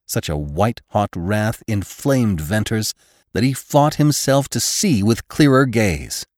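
usual speaking voice

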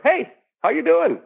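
bright high hello; high cheery greeting. Shown as a demonstration. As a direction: chirp a friendly greeting. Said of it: "if you, like, go, 'Hi, how are you?'".

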